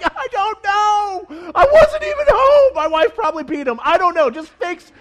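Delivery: hammed-up panic, near crying; panicked dad pleading with the ER doctors, high and loud; frantic young dad. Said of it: "I'm like".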